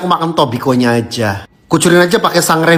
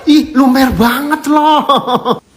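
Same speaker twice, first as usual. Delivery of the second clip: amazed; 8 semitones higher, much slower than usual. Real, not acted. Cheese oozing on tasting.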